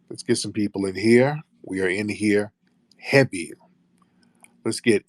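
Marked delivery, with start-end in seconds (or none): none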